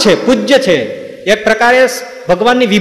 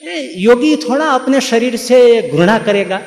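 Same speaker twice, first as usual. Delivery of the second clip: higher, slower mock preacher in Hindi; mocking storytellers; 6 semitones higher, slower than usual. Preacher mocking how storytellers explain, higher slow parody. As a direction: parody the pompous storyteller, drawn out. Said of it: "શું કરે ખબર છે?".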